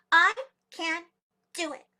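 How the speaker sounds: proud, deliberate declaration with pauses. A narrator's reading. Tiny muskrat puffs out her chest, proudly declaring. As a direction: announce it word by word, loud opening. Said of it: "puffed out her chest very proudly".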